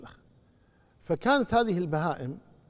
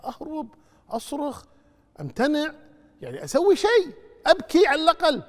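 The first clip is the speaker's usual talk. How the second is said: higher and louder, building up; rising alarm; 12 semitones higher, 8 dB louder than usual. Retold or imitated. Friend picturing himself fleeing the knife, building. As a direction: pile on the protests with growing urgency. Friend imagines resisting slaughter.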